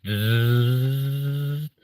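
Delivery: long buzzing fan noise; drawn-out electric whirr; imitated fan whirr. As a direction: drone it like a spinning fan. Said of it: "spinny thing that goes".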